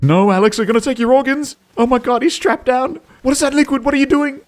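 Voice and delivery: high, frantic squeal of alarm; hammed-up rising hysteria; mock panic. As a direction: fire off horrified questions in a high voice. Mock panic for man on video.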